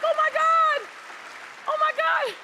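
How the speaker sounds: very high, loud squeal of shock; hammed-up high-pitched amazement; acted astonishment. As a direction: squeal it in overwhelmed recognition. Retold astonished recognition of crying woman.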